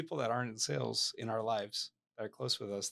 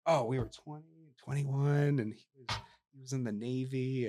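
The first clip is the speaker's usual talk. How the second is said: excited wife; 3 semitones higher, 5 dB louder than usual. Retold or imitated. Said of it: "she jumps right in".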